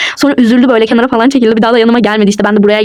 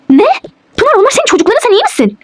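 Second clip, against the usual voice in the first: shocked disbelief; 9 semitones higher than usual. Acted. Rüzgar shocked she rejects children.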